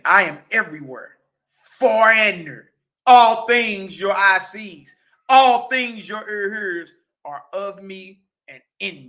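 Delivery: slow, loud, deliberate scripture reading; booming preacher voicing the Creator's words; solemn declamation. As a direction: proclaim each phrase with weight. Rereads the same quote.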